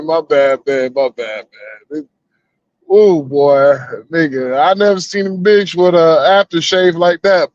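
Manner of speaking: normal talking voice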